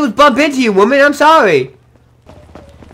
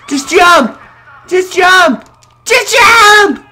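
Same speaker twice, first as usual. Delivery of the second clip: urging, rising to a high shout; urgent shout; 6 semitones higher, much slower than usual. Real, not acted. Urging jump, repeated louder.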